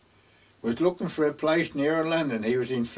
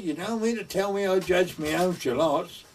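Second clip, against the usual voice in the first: mildly incredulous, higher-pitched retelling; 4 semitones higher than usual. Retold or imitated. Friend realising he judged his own shallots, incredulous. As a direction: act out his disbelief as the truth sinks in.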